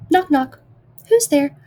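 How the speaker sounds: a hammed-up knock-knock joke; joke voices. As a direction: bounce the knock-knock lines up high.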